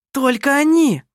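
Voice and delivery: high, loud, vehement retort; spiteful jab. A narrator's reading. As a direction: throw it at her spitefully. Kerstin joins attack on Anna Maria.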